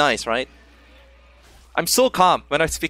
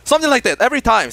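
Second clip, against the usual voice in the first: ordinary talk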